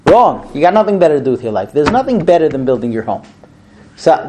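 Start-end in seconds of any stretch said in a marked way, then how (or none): none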